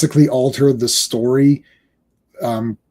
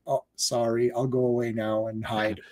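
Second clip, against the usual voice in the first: small, lower, much softer voice; mock sheepish apology; 5 semitones lower, 11 dB quieter than usual. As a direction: shrink away in mock shame, trailing quieter. Retold sheepish retreat after being corrected.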